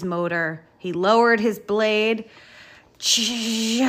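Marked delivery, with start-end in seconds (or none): 3.0-3.9 long, juddering stretched-out battle cry; rallying charge cry. A narrator's reading. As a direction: draw it out like a revving engine. Bulldozer charging at the pile.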